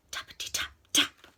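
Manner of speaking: unvoiced little knock sounds; whispered tapping. A narrator's reading. Storyteller voices a third knock at the door, whispered. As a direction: whisper the taps, crisply.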